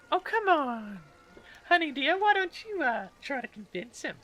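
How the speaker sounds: very high, wheedling lady voice; coaxing lady. Acted. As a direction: wheedle her escort into helping.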